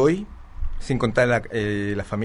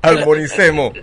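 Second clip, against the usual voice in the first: playful call; 5 semitones higher, 10 dB louder, slower than usual. Real, not acted. Calling for calm.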